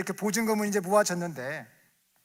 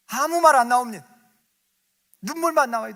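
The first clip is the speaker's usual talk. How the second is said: loud and high, crying out the point; impassioned preaching; 9 semitones higher, 13 dB louder than usual. Acted. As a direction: proclaim it with fervour. Preaching climax on tears. (높고 크게 외치는 설교)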